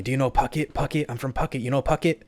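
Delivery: a hint of her accent, asking again and again; imitating the Thai girl. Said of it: "she just starts asking me".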